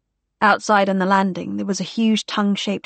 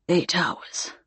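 murmured; 5 dB quieter than usual. A narrator's reading. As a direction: murmur it under the breath. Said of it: "he murmured".

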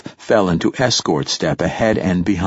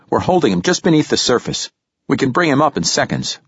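quick, higher clinical report; brisk technician; 6 semitones higher, faster than usual. Acted. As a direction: rattle it off like a busy technician.

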